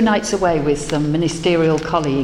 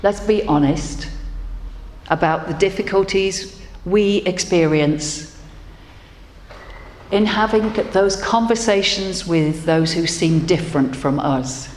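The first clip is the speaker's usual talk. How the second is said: leading prayer. Acted. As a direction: lead the congregation gently into thanks. Leads congregational prayer.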